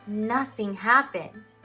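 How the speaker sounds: usual speaking voice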